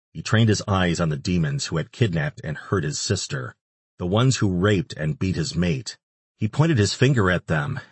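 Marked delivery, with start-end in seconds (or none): none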